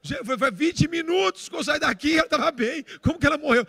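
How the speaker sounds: high, loud, stunned protest; shocked disbelief. Retold or imitated. Son reacting to news that his mother died, shocked disbelief. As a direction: re-enact a loud, disbelieving outcry.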